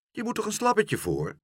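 high, questioning protest; mildly baffled mother; puzzled mother. A narrator's reading.

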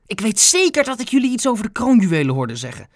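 high, rushed panicked protest; panicky. A narrator's reading. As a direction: blurt it out fast in alarm. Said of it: "zei meneer Moejal paniekerig".

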